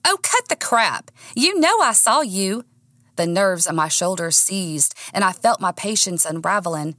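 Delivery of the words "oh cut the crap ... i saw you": higher-pitched, indignant accusation; angry confrontation. A narrator's reading. Woman confronting her boyfriend, indignant. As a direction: call him out, pitch raised.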